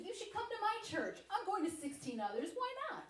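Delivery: high, airy and flippant; laughing at her own nerve on "Why not?"; breezy mock invite. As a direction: toss off the invite with a laughing shrug.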